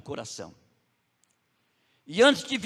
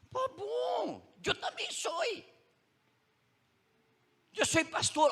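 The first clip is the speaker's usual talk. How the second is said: bem mais agudo, animado até gritar pastor; excited; 11 semitones higher, 4 dB quieter than usual. Retold or imitated. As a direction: explode de alegria ao dizer que é pastor. (pregador contando ao suíço, empolgado e agudo)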